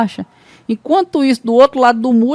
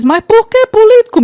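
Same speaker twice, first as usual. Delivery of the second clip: much higher, louder shocked cry; surprised mother; 14 semitones higher, 5 dB louder than usual. Acted. Mother shocked by her son's career choice, high surprised cry. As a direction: gasp it out in disbelief. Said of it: "Aí a mãe surpresa diz".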